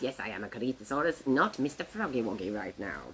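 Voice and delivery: low, hurried insistence in character; frog playing Corythosaurus. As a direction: insist in a deep puppet voice. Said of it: "I shall play the Corythosaurus".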